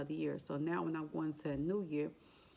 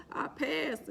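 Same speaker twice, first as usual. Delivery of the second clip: much higher, breathless with joy; stunned joy; 10 semitones higher than usual. Retold or imitated. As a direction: gasp it out as the news hits.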